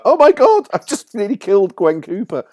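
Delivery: very high, loud, aghast; horrified. Retold or imitated. Writer horrified after nearly headbutting the actress, high and aghast. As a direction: gasp it out in horror. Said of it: "I was just like".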